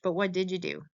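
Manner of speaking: ordinary talk, nothing marked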